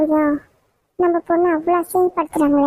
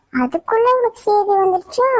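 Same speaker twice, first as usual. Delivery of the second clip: surprised question; 6 semitones higher than usual. Acted. Mother startled news spread.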